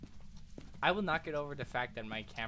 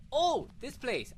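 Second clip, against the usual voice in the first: high, slow, drawn-out oh; dramatic recognition; 10 semitones higher, 5 dB louder, much slower than usual. Real, not acted. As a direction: savour the memory with awe. Recognizing a remembered haunted map.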